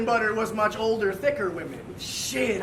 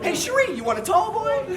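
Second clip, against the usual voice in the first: mock pickup voice; 6 semitones higher than usual. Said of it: "It's like".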